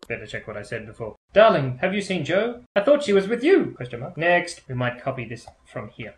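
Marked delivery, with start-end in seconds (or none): 1.3-3.7 acted comic line. Reading her own comic line.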